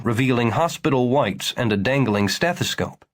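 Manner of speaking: ordinary delivery, nothing marked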